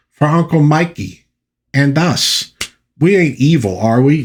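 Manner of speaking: high-pitched innocent character voice; Zip. Retold or imitated. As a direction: put on a high voice for a hurt, simple soul. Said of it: "Zip stands in the way and takes the hit".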